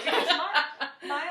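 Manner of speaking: loud, high, slowed by laughter; laughing warning. Real, not acted. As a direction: struggle to explain while cracking up. Jokingly warning friends amid laughter.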